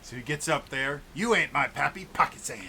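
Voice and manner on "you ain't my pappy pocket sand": loud character shout. Acted. Character quote in a game.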